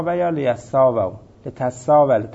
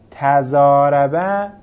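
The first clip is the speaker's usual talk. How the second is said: slow, careful demo form; 5 dB louder, much slower than usual. Shown as a demonstration. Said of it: "یعنی".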